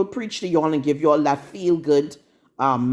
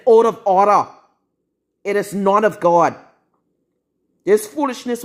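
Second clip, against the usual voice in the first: loud stern rebuke; 2 semitones higher, 4 dB louder than usual. Real, not acted. Rebuking women online.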